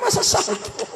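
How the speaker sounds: high breathy laughing question; amused incredulous aside; laughing disbelief. Real, not acted. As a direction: scoff through a laugh. Mocking the wailing widow.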